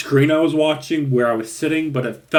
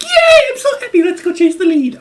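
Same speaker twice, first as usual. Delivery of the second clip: squeaky, much higher, louder fake glee; mock cheerful; 7 dB louder than usual. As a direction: parody her inappropriately happy reaction. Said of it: "she's like".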